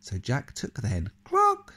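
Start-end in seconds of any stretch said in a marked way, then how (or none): 1.2-1.7 very high, loud squawked cluck; hen cluck. Acted. The hen answering the giant, high squawked cluck. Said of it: "said the hen".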